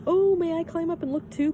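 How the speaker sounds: high, loud pleading call; eager call. A narrator's reading. Tommy calling up to Pippi in the tree, eager high call. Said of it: "called Tommy".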